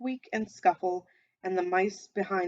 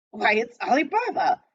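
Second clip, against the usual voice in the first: loud, delighted cry of recognition; ecstatic exclamation; 4 semitones higher, 7 dB louder than usual. A narrator's reading. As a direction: burst out in joyful surprise. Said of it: "Scrooge exclaimed in ecstasy".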